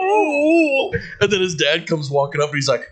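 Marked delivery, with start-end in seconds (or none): 0.0-0.9 high laughing sob sound; mock crying wail. Imitating the kid's cry after being told off. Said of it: "He went".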